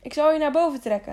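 acted strain of hauling someone up; panting. A narrator's reading. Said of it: "hijgde hij". (hijgend, gejaagd)

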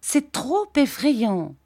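put-on high, drawn-out childlike wail; plaintive high-pitched girlish cry. A narrator's reading. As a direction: wail in alarm, slowly. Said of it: "cria Dora".

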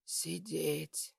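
soft, weak command; whispered order. A narrator's reading. Weak patient stopping her from leaving, whispered order. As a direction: order her quietly, strength running out. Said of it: "тихо прошептал я".

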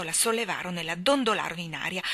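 ordinary delivery

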